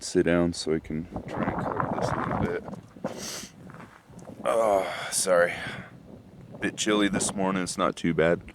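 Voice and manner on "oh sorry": ordinary delivery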